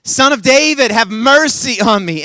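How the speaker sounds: loud, high insistent plea; big, pressing cry for mercy. Acted. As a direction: shout over those silencing you. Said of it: "he only shouted louder".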